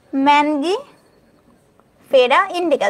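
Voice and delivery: ম্যানগিফেরা stretched out slowly, syllable by syllable; slow syllable demo. Shown as a demonstration. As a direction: spell out ম্যানগিফেরা very slowly for the class. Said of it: "ঠিক আছে?". (ধীরে ধীরে ভেঙে উচ্চারণ)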